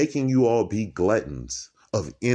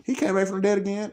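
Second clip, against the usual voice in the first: high put-on gossipy voice, much higher; mocking onlookers; 13 semitones higher, faster than usual. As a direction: squeak it like chattering bystanders. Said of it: "They're like".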